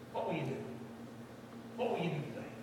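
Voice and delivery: ordinary talk, nothing marked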